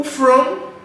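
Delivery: from said high and loud; from hit hard, rising; stressed from. Shown as a demonstration. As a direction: punch from. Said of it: "que a gente focou na pergunta".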